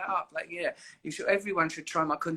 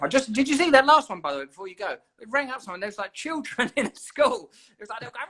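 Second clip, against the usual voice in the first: excited retelling; 12 semitones higher, 3 dB louder than usual. Real, not acted. Excitedly retelling chaotic clip.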